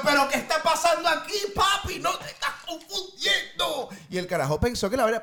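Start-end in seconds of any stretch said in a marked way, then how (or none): 0.0-3.9 alarmed retort. Retold or imitated. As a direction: push back at the guy who got the wrong idea. Confronting man who misread him.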